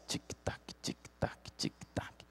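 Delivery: tick-tock breathed with no voice; a soft, voiceless tick-tock; whispered tick-tock. Acted. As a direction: whisper it like a ticking clock. Clock ticking, whispered.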